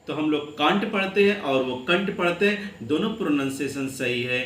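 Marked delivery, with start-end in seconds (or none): none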